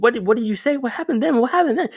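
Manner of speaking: mock-eager pestering on a call with his crush; desperate curiosity. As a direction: beg for the rest of the story, pitch rising. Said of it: "I was like".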